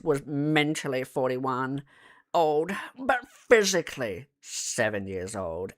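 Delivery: ordinary delivery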